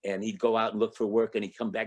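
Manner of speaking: ordinary talk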